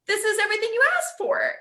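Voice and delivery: quick, high, upbeat reassurance. Acted. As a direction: sell it like a cheerful guide.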